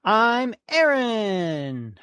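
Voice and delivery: loud, slow, showman introduction; drawn-out intro. Shown as a demonstration. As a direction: stretch out the name like a host. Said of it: "let me try again".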